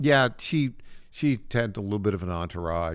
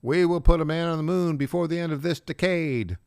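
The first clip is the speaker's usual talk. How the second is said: put-on Boston Kennedy accent, higher and louder, like the famous speech; 6 semitones higher, 4 dB louder than usual. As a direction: orate it like JFK's moon speech in a Boston accent. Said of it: "the Kennedy accent".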